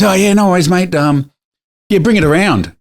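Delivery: lightly voiced detailer saying no worries; breezy tradie. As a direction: play the relaxed car-wash guy. Voices the car-wash guy.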